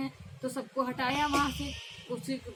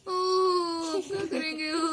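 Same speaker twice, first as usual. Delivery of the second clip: loud wailing sobs, voice cracking; crying aloud in despair; acted wailing; 4 semitones higher, 3 dB louder, much slower than usual. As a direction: wail it out like a grieving bird. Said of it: "बहुत रो रही".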